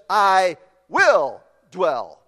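"will" drawn out slow and firm; stress on WILL. Shown as a demonstration. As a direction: lean hard on "will", very slow. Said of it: "He writes this".

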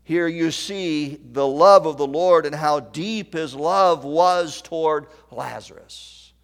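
ordinary talk

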